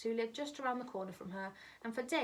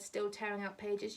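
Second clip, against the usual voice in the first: ordinary delivery, nothing marked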